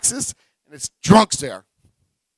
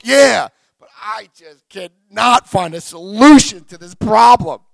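loud, slow complaint at the top of the voice; exasperated shout; 10 dB louder, slower than usual. Retold or imitated. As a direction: shout it like you're fed up and stuck. Frustrated newcomer complaining.

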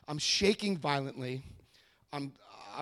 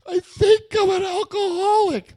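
very high, loud mock wail; over-the-top squealed admission; hammed-up wailing confession; 13 dB louder than usual. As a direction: blurt it in a huge, high-pitched cry. Acted confession to the hotline.

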